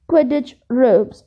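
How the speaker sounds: plain reading voice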